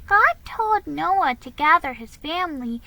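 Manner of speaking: put-on high, curious child asking a question; a young girl asking about Noah; little girl voice. A narrator's reading. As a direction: pitch it up like a small girl puzzling. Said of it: "said Nan".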